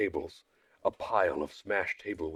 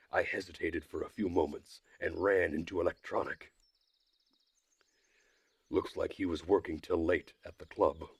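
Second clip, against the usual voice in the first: plain reading voice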